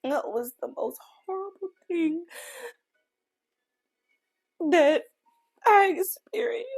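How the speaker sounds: real sobbing, pitch rising high as it breaks; crying. Real, not acted. Grieving mother telling viewers her worst moment, crying. Crying over baby's death.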